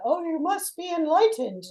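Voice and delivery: high, put-on gushing voice; mock over-eager. Mock coach rushing someone up to enlightenment, over-eager. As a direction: push it high like a hammed-up zealot.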